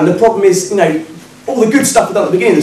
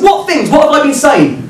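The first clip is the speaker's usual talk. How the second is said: high-pitched, incredulous questions; indignant protest; 9 semitones higher than usual. Retold or imitated. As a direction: demand an answer, voice pitched up. Argues back at the drunk man.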